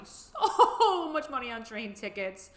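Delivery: slow, laughing through it; slowed rueful laughing. Real, not acted. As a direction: laugh at the loss, dragging it out. Rueful about lost money.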